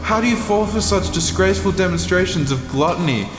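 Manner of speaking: ordinary talk, nothing marked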